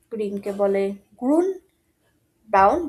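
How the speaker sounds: usual speaking voice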